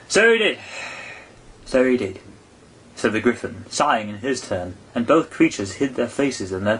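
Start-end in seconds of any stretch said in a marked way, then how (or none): 0.1-0.6 high, loud emphatic agreement; loud, high first so he did. A narrator's reading. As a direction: agree with a wistful sigh, firmly first. Gryphon sighing agreement, first take.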